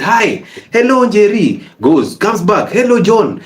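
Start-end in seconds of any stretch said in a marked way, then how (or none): 0.0-1.5 higher, sing-song mock hello; pesky neighbor greeting. Neighbor passing the gate again, chirpy higher mock greeting. 2.7-3.4 higher, sing-song mock hello; pesky neighbor greeting. Neighbor passing the gate again, chirpy higher mock greeting.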